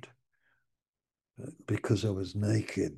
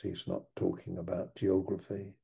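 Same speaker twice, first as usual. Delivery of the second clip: ordinary talk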